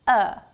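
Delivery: short neutral "er" sound; schwa vowel. Shown as a demonstration. Teacher demonstrates the weak vowel "er". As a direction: say "er" as a relaxed schwa. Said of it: "the vowel sound of the word is pronounced".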